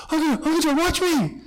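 put-on high, eager child's voice; excited little kid. Little kid about to spin for uncle, excited. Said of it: "You've had little kids do that too".

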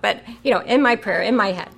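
normal talking voice

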